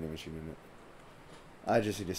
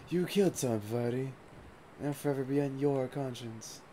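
higher put-on voice for a mock reproach; mock solemn scolding; 5 semitones higher, 3 dB quieter than usual. Player redoing the scolding of Bavardi in a higher put-on voice. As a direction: redo the line as a hammed-up moral lecture. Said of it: "was just really dumb".

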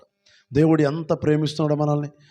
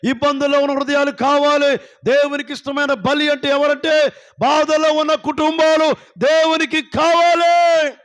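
high sustained preaching cry, growing louder to the last drawn-out word; preaching, building to full force; 8 semitones higher, 4 dB louder than usual. Real, not acted. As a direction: build each line higher into a ringing climax. (ఉధృతమవుతున్న ప్రసంగ స్వరం)

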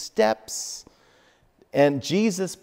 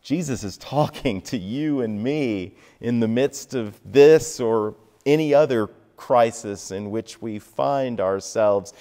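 normal talking voice

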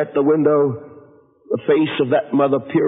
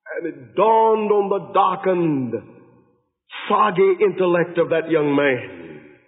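ordinary talk

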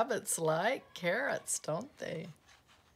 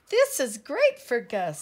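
loud, higher-pitched enthusiasm; excited announcement; 5 semitones higher, 11 dB louder than usual. Acted. Pip showing off a gift for Gus, excited announcement. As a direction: announce the find loudly and eagerly. Pip proudly presents a find.

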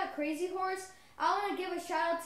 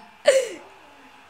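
loud high laugh; excited laugh; 8 semitones higher, 13 dB louder than usual. Real, not acted. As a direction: burst out laughing. Laughing after scoring.

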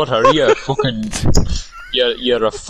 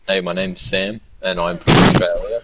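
lower-pitched put-on Australian accent; 5 semitones lower than usual. Shown as a demonstration. Friend asked to show his Australian accent, putting it on low. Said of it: "I'll fucking put it on for you".

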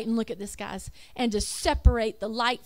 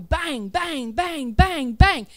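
building bangs; 6 dB louder, much slower than usual. Real, not acted. God signalling, building bangs.

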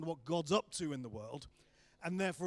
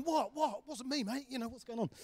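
higher, startled protest of innocence; a pitched-up what-me defence; 8 semitones higher, 5 dB louder than usual. Retold or imitated. The guy on the right protests to Ben, higher and startled. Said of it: "And he's going".

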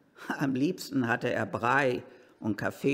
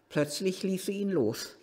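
usual speaking voice

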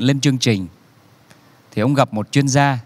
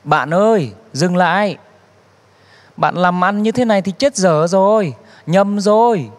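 shouting after him; 5 semitones higher than usual. Retold or imitated. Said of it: "chạy xe đuổi theo và hét".

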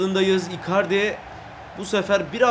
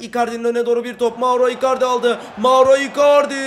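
fast, then louder and higher calling of the name; excited commentary; 4 semitones higher, 7 dB louder than usual. Real, not acted. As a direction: build to the chance. Gol pozisyonu anlatımı. (yükselen heyecanlı anlatım)

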